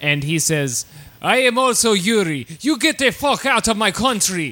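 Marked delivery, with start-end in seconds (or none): 1.2-4.5 hammed-up Russian accent, pitched a little high. Russian boy confronting a rival at the stall, hammed-up Russian accent. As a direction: order him out in a mock Russian accent. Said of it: "that little Russian hot fella comes in".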